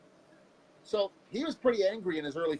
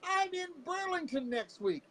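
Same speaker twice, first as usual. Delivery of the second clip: prank caller voice; slower than usual. Retold or imitated. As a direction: put on a goofy high caller voice. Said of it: "pretended to be somebody else".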